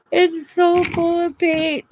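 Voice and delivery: high, loud and slow, near laughing; amused disbelief. Real, not acted. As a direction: marvel at the messy sleeves. Showing paint-covered sleeves.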